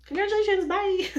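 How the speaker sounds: high, loud sing-song goodbye; breezy mock farewell. NICU team hurrying out of the room, loud cheery goodbye. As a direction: toss it off cheerfully on the way out.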